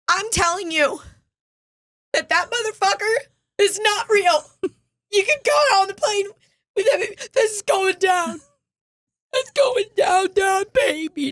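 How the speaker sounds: high-pitched diva voice copied; high impersonation. Retold or imitated. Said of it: "That was fucking good".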